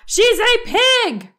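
high, loud, slow indignant yell; angry shout. A narrator's reading. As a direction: insist hotly it's just a pig. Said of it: "shouted Eugenia".

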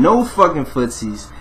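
ordinary delivery

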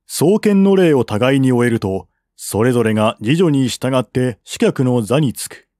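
usual speaking voice

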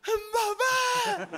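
put-on a high, loud wailing cry for mum, as a small boy; crying child's shout. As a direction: sob it out like a panicked kid calling his mother. Child runs crying to mother.

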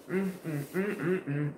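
soft savoring mm; savoring hum. Real, not acted. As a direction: hum with pleasure over food. Tasting food, eating.

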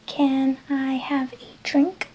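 slow, careful English question; slow teacher delivery; slow model question. Shown as a demonstration. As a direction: say it slowly for learners. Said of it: "Es igual que".

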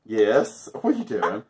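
usual speaking voice

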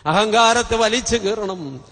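ordinary talk

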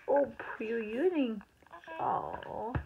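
normal talking voice

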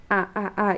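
"ah" repeated as separate short vowels; evenly repeated initial vowel "ah"; sounding out. Retold or imitated. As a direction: imitate a child sounding out "ah, ah, ah". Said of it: "empieza a repetir los sonidos".

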